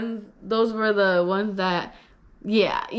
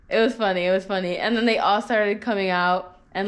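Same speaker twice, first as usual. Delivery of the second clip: ordinary talk, nothing marked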